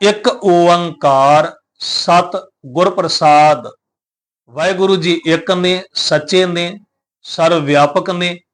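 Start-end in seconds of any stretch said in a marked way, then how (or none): 0.1-3.7 each word slowly and clearly, chant-like; slow recitation. Shown as a demonstration. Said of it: "ਉਚਾਰਨ ਕਰਨਾ ਹੈ".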